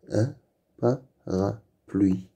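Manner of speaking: parapluie split slowly into pa-ra-pluie; slow, separated syllables on "Un parapluie". Shown as a demonstration. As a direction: sound out "parapluie" with gaps between syllables. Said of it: "avec le son bl".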